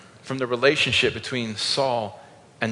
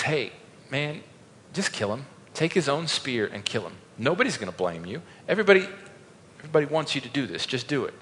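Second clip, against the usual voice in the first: hushed urging; 7 dB quieter, faster than usual. Acted. David's companion urging him beside sleeping Saul, hushed. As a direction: keep it soft, as if someone is asleep nearby.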